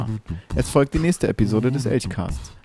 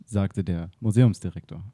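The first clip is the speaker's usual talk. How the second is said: radio play narration; 4 semitones lower than usual. A narrator's reading. Narrator setting the museum scene, low and hushed.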